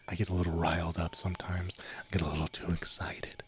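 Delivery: sheepish confiding whisper; ASMR whisper. Acted. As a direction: whisper it like a little confession. Whispered ASMR stream chatter.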